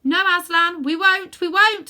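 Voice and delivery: loud, high chorus promising; eager chorus. A narrator's reading. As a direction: shout the promise back eagerly. Everyone answers the lion.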